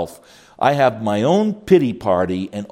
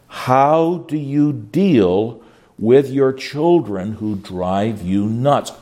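ordinary delivery

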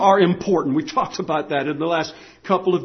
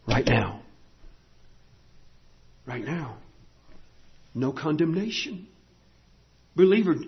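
soft, spaced-out pulpit appeal; low-key, searching sermon voice with long pauses; hushed preaching; 3 semitones lower, 8 dB quieter than usual. Real, not acted. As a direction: let each phrase sink in, quietly.

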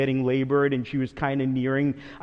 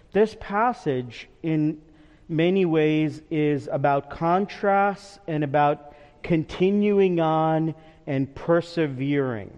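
ordinary delivery

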